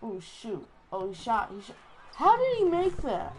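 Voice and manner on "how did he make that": loud, high cry of disbelief; disbelieving outcry. Real, not acted. Player reacting to an opponent's basket, disbelieving outcry. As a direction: yell out in disbelief at the shot.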